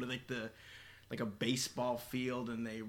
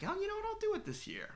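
high bright self quote; 3 dB quieter, much faster than usual. Retold or imitated. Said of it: "I was like".

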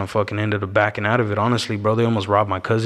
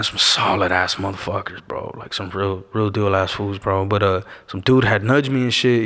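ordinary talk, nothing marked

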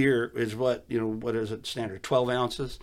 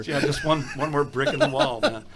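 laughing; 3 semitones higher, 6 dB louder than usual. Real, not acted. Joking while laughing.

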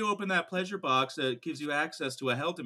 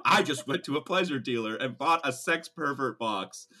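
ordinary delivery

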